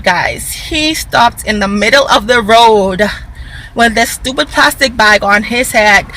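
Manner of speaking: normal talking voice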